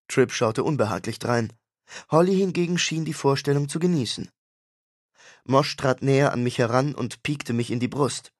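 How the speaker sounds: normal talking voice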